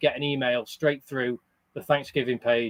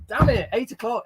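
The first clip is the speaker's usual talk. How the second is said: higher-pitched mild mock outburst; mock exasperation; 7 semitones higher than usual. Streamer recalling missed live streams, mock exasperated. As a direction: imitate your own annoyed reaction. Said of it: "I'm always like".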